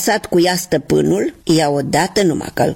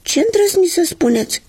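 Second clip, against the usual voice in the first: much higher, mock-offended outcry; indignant cry; 6 semitones higher than usual. New shoes in the drawer answering the insult, indignant and higher. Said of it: "strigară în cor".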